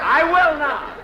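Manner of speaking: put-on high, loud childish refusal; like a baby. Jack refusing Mary's order to come back, high petulant child voice. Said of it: "Oh, what a baby".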